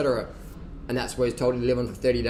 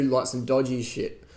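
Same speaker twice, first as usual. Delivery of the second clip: usual speaking voice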